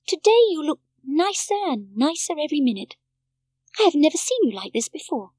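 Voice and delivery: put-on a little girl delighted with grandfather; Heidi's voice. A narrator's reading. Heidi tells her grandfather he looks happier, lightly childlike.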